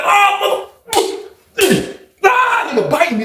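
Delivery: high-pitched mock cry of pain; pained yelp. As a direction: break off the curse as the bite hurts. Acts out being bitten.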